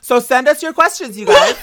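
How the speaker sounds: loud, high hyped plea; excited. Real, not acted. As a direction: pitch it up like a cheerleader.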